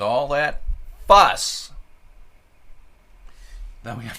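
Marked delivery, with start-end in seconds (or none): 0.0-1.7 louder, stretched "fuss"; exaggerated flourish. Real, not acted. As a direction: make a big deal of it.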